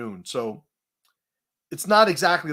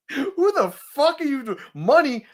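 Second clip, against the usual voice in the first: incredulous high yelling; 10 semitones higher, 5 dB louder than usual. Retold or imitated. Mocking the rich guy's money boast.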